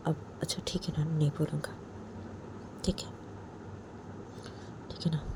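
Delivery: soft whisper. Acted. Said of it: "अब जैसे बोलती है".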